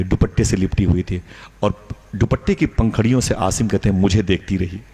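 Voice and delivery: ordinary talk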